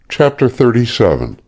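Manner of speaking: plain reading voice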